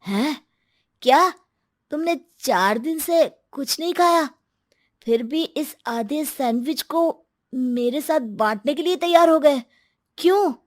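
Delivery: stunned disbelief; astonished. Acted. Ankur asking the starving man why he would share, astonished.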